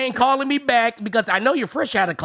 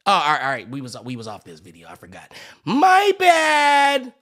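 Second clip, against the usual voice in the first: ordinary talk, nothing marked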